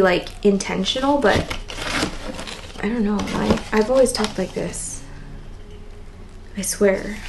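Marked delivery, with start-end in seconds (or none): 2.8-7.2 raspy voice. Real, not acted. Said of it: "I'm extra raspy right now".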